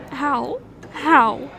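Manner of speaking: ordinary talk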